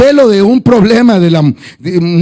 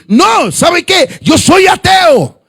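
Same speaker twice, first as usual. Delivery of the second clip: defiant shout; 7 semitones higher, much slower than usual. Retold or imitated. Atheist man to the pastor, slow defiant shout. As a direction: slam the door on the conversation.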